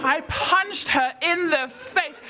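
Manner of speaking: high, stretched slow-motion replay; dramatically slowed retelling. Acted. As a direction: drag out every word like a replay. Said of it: "saw this happen in slow motion".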